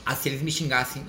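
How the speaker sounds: usual speaking voice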